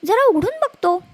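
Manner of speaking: a wolf surprised by a walking pumpkin; startled curious wolf. Acted. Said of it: "तो म्हणाला".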